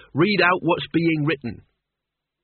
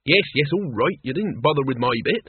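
usual speaking voice

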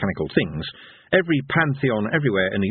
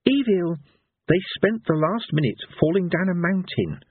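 higher-pitched, reasoning objection; doubting minstrel; 9 semitones higher than usual. A narrator's reading. Minstrel doubting anyone survived the fall, incredulous. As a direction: point out the obvious to him. Minstrel disputes villain's hope.

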